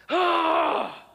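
sudden high, loud exclamation "Ah!"; wife's loud upset cry; retold angry yell. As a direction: blurt out a sharp startled-angry "Ah!". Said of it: "make her so mad at me".

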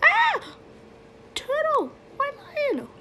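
very high, shocked outburst; high-pitched pretend alarm; startled cry. Figures startled at the costume swap.

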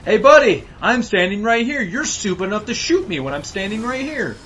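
high, loud mock soldier; dumb grunt voice. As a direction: play the taunting grunt. Voicing the enemy soldier.

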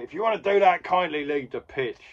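loud, high scolding ref; stern ref. Retold or imitated. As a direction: tell him off from the touchline. Ref telling Mouse off.

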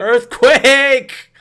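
high, loud mock alarm cry. To the movie, a loud mock alarm cry. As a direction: shout it like a warning.

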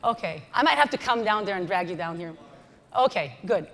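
ordinary talk, nothing marked

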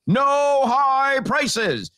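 high, slow drawn-out shout; mock shout. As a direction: bellow it like a decree.

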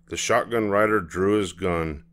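plain reading voice

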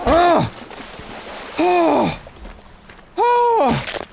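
high-pitched drawn-out cries; repeated high "oh" yelps under attack; panicked yelps. Real, not acted. Player fleeing an attack in the game, high panicked yelps.

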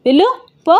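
put-on annoyed young master snapping at the maid to go; snapping angrily. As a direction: shoo the maid off, rising to a sharp bark. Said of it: "గసిరాడు Venkat".

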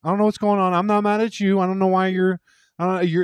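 very high, loud protest; high-pitched, baffled self-defence; retold defensive plea. Retold defence to the angry aunt.

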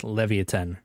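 Leviathan said as leh-vee-ah-TEN; preferred pronunciation. Shown as a demonstration. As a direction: say Leviathan with an ee in the middle and a hard T. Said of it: "I'm pretty sure it's pronounced".

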